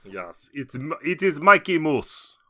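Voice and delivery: slow Russian hawker; hammed-up Russian sales pitch; mock Russian accent. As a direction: peddle it in a fake Russian accent. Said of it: "turned off our entire Russian audience".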